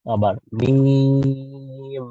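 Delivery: মিম stretched very slow; drawn-out mim. Shown as a demonstration. Teacher modelling the letter name, drawn-out মিম. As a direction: hold মিম out long. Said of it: "drawn-out letter demo".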